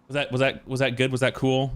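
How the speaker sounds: ordinary talk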